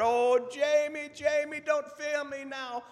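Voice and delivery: frantic mock-Scottish doctor; Scottish brogue. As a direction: plead at the top of the voice in a hammed-up Scottish brogue. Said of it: "best Scottish brogue I can come up with".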